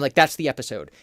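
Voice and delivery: ordinary talk, nothing marked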